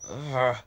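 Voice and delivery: loud pained grunt through gritted teeth; a sharp strained ugh, teeth clenched; gritted groan. Acted. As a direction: grunt as head pain doubles. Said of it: "I gritted".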